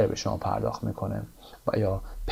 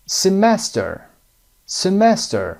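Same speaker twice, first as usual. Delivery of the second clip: Semester with the stress on -mes-, louder and higher; teacher stresses Semester; stressed model word; 8 semitones higher, 4 dB louder, much slower than usual. Shown as a demonstration. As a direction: lean on the middle of Semester.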